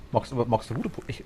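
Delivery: ordinary talk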